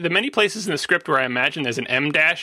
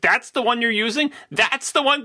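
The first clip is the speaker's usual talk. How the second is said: higher-pitched mock disbelief; incredulous questioning; 5 semitones higher than usual. Podcaster questioning a director's choice of take, incredulous. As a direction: question it as if you can't believe it.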